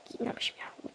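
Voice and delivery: soft unvoiced whisper. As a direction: whisper it like a secret. Same words whispered right after.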